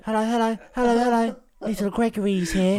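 high-pitched, put-on little character voice; Little Gregory. Podcaster playing at suddenly speaking differently, silly high voice. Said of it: "just started speaking differently".

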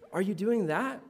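usual speaking voice

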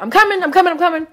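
high, loud hollering out. Retold or imitated. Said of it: "I hollered out".

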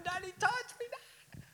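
high, strained, voiceless squeak; no voice left. Retold or imitated. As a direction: imitate an excited child who lost his voice. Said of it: "we had no voice".

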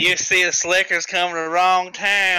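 hammed-up Southern town local; Southern accent. Shown as a demonstration. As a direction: put on your best Southern accent. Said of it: "give me your best Southern accent".

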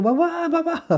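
put-on high tearful child's cry of mamá. A boy crying to his mother after the angel answers, high and tearful. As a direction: call out like a weeping little boy.

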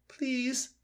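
very high pleading fox voice; begging fox. Acted. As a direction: beg sweetly for the egg.

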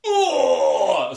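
loud gagging cry of disgust; disgusted gasp. Acted. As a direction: cry out at the stench. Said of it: "And then you're like".

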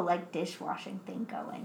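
soft, slow whisper; whispered aside. Real, not acted. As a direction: drop to a whisper. Trailing aside, voice drops.

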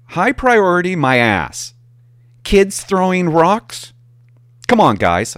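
loud, scornful mock protest; a loud, scoffing retort to the sergeant. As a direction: scoff loudly at the idea.

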